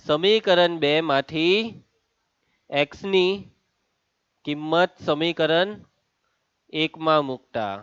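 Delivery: drawn-out, spaced phrases with long pauses; slow dictation. Real, not acted. Teacher dictates the substitution step to the class, slow dictation. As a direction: dictate slowly, piece by piece.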